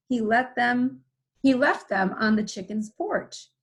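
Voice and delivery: ordinary delivery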